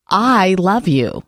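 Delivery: "I love you" with I leaned on, slow and drawn out; stress on I. Shown as a demonstration. Host on air showing how stress changes meaning, stress on "I". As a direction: hit "I", then let "love you" fall away slowly. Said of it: "Each one has a different meaning".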